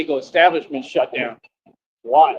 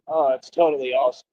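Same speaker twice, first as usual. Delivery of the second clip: normal talking voice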